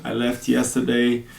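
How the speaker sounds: normal talking voice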